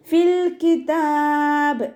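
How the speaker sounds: slow, high, melodic tajweed chant; Quran recitation. Shown as a demonstration. Teacher chants the verse for students, slow and melodic. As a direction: draw each syllable out in a sung recitation.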